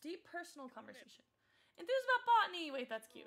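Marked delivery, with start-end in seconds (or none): 1.8-2.7 much higher and louder, thrilled; excited exclamation. Real, not acted. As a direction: blurt it out delighted. Reading Sim trait options.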